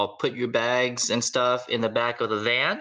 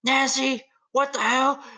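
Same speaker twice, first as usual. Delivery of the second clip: much higher mock yell at Nancy; hammed-up angry father; dad yelling; 3 dB louder than usual. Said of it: "your dad says".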